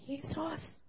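a soft, hushed call of the name; whispered back. A narrator's reading. Boy whispers back to Jesus in the stable, hushed. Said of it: "flüsterte er zurück".

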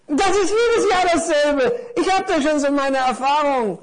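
high, squeaky know-it-all parcel; world-weary little parcel. Acted. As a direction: share your experience in a high little voice. (erfahrenes Päckchen mit hoher Stimme)

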